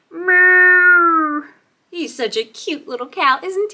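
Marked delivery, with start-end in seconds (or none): 0.1-1.4 high, loud, long moo; cow moo. Acted. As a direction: imitate a cow with a held call. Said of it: "says the cow".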